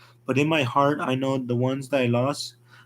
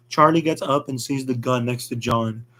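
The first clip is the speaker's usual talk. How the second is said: usual speaking voice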